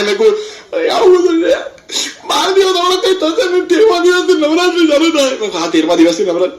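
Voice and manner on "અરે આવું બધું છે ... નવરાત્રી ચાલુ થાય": high, hammed-up sobbing; mock crying. Mourning nephew counts days to Navratri, mock crying. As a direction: lament it in a put-on tearful wail. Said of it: "રડ નહીં, રડ નહીં".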